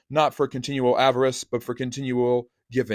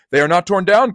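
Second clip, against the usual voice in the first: high, loud joyful cry; overjoyed cry; 7 semitones higher, 7 dB louder than usual. Acted. Scrooge to his bed curtains, overjoyed high cry. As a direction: cry it out in delight. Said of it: "cried Scrooge".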